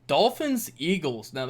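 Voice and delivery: ordinary talk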